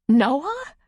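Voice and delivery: high, rising, startled question; incredulous. A narrator's reading. Woman asked if he hurt her, incredulous. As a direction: throw the name back in disbelief.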